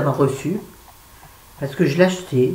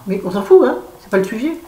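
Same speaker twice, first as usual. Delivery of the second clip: dismissive; 8 semitones higher, 5 dB louder, faster than usual. Real, not acted. Brushes topic off to viewers.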